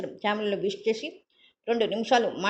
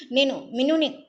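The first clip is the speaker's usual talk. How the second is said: higher and louder, urgent declaration; loud cry; 5 semitones higher, 5 dB louder than usual. Acted. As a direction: call it out, insisting who she is. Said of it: "బిగ్గరగా అంది".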